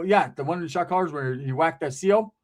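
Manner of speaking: plain reading voice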